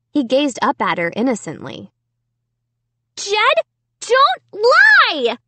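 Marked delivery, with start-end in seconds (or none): 3.2-5.4 high, loud scream. A narrator's reading. Sarah screaming at her brother, high angry scream. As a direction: scream it at him. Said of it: "Sarah screamed".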